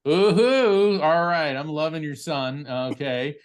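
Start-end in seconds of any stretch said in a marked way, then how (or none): 0.1-1.0 high, loud hoot of delight; excited high-pitched hoot; delighted "ooh-hoo". Real, not acted. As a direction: whoop it out, drawn-out and rising high. Delighted reaction to name match.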